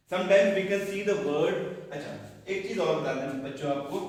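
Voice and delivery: ordinary talk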